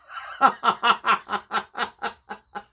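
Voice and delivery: long, helpless chuckle at yourself; real laughing fit. Real, not acted. Crafter laughing at her own light bulb moment, helpless laughter.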